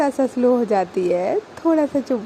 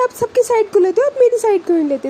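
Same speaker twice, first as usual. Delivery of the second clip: higher and fast, accusing; heated complaint; 7 semitones higher, faster than usual. Retold or imitated. Baani accusing her partner of never taking her side, fast and heated. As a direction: fire off the grievance quickly.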